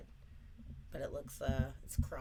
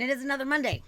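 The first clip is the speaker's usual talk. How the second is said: loud announcer voice; 11 semitones higher, 12 dB louder, faster than usual. Pretend.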